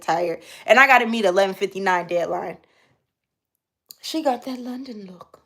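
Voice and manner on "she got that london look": softer, higher stab at a London accent; attempted London accent. Shown as a demonstration. Streamer trying out the London look line, attempted London accent. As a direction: have a light go at a London accent. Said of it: "I can't do a London accent, but I try".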